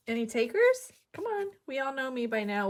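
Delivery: normal talking voice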